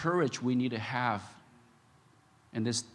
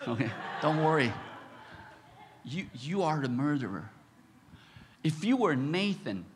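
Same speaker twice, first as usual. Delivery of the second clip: ordinary talk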